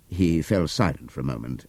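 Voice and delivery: usual speaking voice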